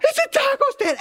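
high, wailing sob; sobbing child. Retold or imitated. Brother wailing at the taco stand, high sobbing. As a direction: wail it like a heartbroken nine-year-old.

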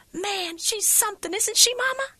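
put-on high, delighted kid's voice; a little girl loving the show; thrilled child. Nine-year-old daughter thrilled.

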